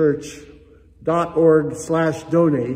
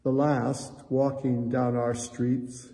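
hushed church prayer; 3 semitones lower than usual. Acted. Leads a closing prayer.

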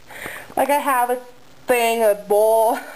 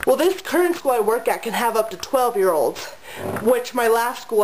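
ordinary talk, nothing marked

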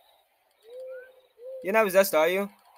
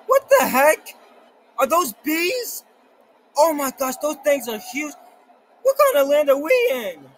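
shocked disbelief; 8 semitones higher, 4 dB louder than usual. Real, not acted. Giant bees appear in game.

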